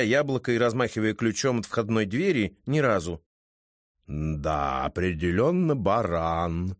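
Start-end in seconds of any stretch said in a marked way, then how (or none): 4.1-6.8 low, slow, musing to himself; a lightly voiced bored cat; snooty bored cat. A narrator's reading. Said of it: "словно бы рассуждая с самим собой".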